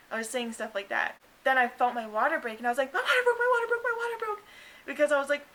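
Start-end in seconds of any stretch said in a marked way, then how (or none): 2.9-4.4 high, loud, urgent repeating; excited announcement. Retold or imitated. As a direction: blurt it out in a thrilled rush. Announcing her water broke.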